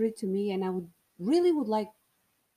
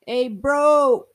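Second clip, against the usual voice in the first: imitated bro greeting; 6 semitones higher, 12 dB louder than usual.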